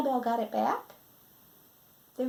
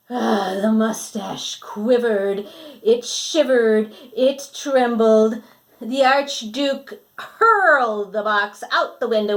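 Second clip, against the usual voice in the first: slow, louder building suspense; dramatic storytelling; 9 dB louder, slower than usual. Acted. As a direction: draw it out with mounting drama. Dramatic narration of rage.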